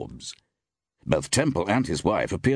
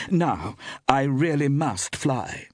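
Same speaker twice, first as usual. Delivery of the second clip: tag; 7 semitones higher, slower than usual. A narrator's reading. Iris voiced in narrated story.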